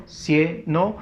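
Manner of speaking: "cieno" split in two, force on CIE; stress on CIE. Shown as a demonstration. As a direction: sound out CIE-no, stressing the first syllable of "cieno". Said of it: "fuerza de voz en la cie". ("cieno" con fuerza de voz en cie)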